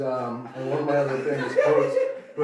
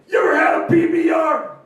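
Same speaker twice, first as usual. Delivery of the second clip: much higher, louder party yell; hammed-up shout; 11 dB louder than usual. Comic yelling at the audience, loud high shout. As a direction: bellow it at the crowd. Shouting catchphrase bit to crowd.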